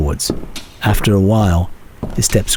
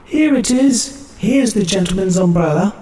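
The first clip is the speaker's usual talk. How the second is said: shrill reply; 11 semitones higher than usual. A narrator's reading. Chambermaid answers the call as she runs, high and shrill. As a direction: answer high and shrill while running down the passage. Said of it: "a shrill reply as she ran".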